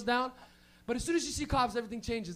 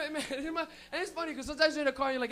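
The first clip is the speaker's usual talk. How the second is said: ordinary talk, nothing marked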